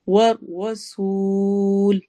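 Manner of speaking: والرسول with سول held extra long at the stop; long stop madd. Shown as a demonstration. Teacher showing madd arid at the stop. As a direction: hold the final vowel long when you stop. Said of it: "مد عارض وقفی ہوگی".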